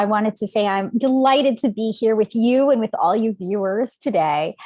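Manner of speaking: ordinary talk, nothing marked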